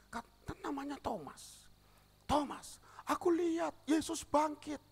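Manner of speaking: high, loud excited report; excited telling. Acted. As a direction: tell the news breathlessly high and loud. Retelling Mary to Thomas. (bercerita dengan suara tinggi penuh semangat)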